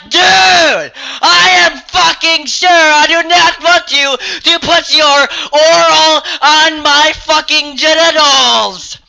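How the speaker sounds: high, loud, slow outraged yell; imagined outburst at the creep, shouting; furious shout. Retold or imitated. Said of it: "I didn't say that".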